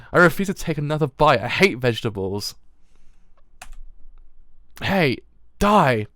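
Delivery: loud, bratty yell; Power tantrum. Acted. Fiend girl refusing vegetables, shouting tantrum. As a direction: throw a fit and shout.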